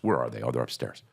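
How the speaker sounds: usual speaking voice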